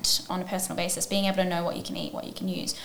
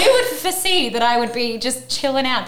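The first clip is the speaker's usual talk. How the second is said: laughing; 9 semitones higher, 9 dB louder than usual. Real, not acted. Laughing disbelief at her old plan.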